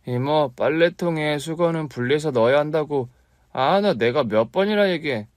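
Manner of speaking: high, lightly voiced boy nagging. Nephew nags his aunt about laundry, lightly high. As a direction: scold like a fussy kid.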